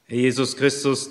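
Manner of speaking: ordinary talk, nothing marked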